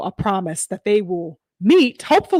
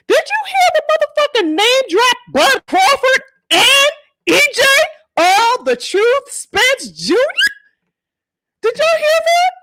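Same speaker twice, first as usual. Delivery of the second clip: high, loud, laughing excitement; can't contain the hype; pitched-up gleeful shouting; thrilled; 13 semitones higher, 4 dB louder than usual. Real, not acted. Host thrilled by name drop.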